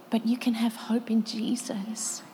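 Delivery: usual speaking voice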